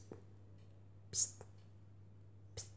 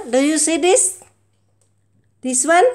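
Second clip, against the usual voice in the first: even louder taunting questions; louder teasing; 5 semitones higher, 13 dB louder than usual. Real, not acted. Owner teasing her cat even louder. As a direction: push the teasing harder.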